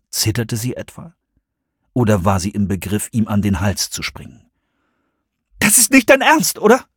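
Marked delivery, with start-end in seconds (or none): 5.6-6.9 hohe, lautere Frauenstimme, fassungslos; ausbrechend. A narrator's reading. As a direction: lass es aus ihr herausbrechen, hoch und laut. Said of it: "brach es aus ihr heraus". (hoher, lauter Ausbruch)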